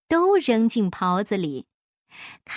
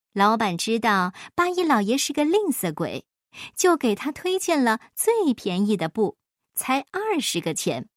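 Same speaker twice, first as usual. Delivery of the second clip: ordinary delivery, nothing marked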